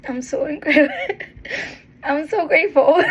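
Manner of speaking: real emotional laugh of gratitude. Real, not acted. Laughing emotionally, telling viewers she is grateful. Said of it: "I got a little emotional".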